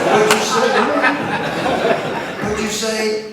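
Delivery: hammed-up grandpa demanding a repeat; stern scolding.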